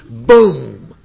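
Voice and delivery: loud, booming one-word punch; punchy exclamation. Real, not acted. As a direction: land it like a knockout blow. Punctuates the zinger he read.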